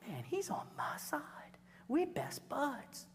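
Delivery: hushed pleased whisper; whispered inner thought. Retold or imitated. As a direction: whisper the teen's smug thought. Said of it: "the seniors thinks".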